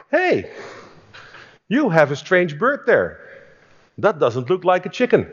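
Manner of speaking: high, surprised "Hey" and a puzzled question; curious friend. Retold or imitated. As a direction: voice the visiting friend noticing the odd bird. Friend voiced in story.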